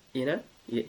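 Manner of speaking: usual speaking voice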